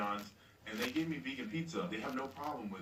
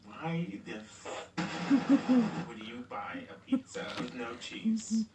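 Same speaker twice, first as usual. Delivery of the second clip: laughing; 12 semitones higher, 7 dB louder, slower than usual. Real, not acted. Laughing at own absurd order.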